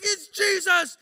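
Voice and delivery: high, loud frantic cry; shouting. Retold or imitated. Shaking coworker answering the one who ran in, shouting. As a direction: call it out in high-pitched amazement. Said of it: "he's just shouting".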